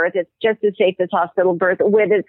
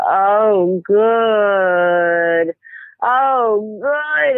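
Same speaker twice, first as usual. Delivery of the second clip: lowered labor moan; much slower than usual. Retold or imitated. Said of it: "she began saying as contractions came".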